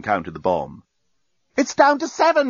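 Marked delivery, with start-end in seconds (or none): 1.6-2.5 very high, frantic cry; panicked yell. A narrator's reading. Journalist hearing the countdown drop, high panicked yell. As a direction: yell the warning. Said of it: "yelled the journalist".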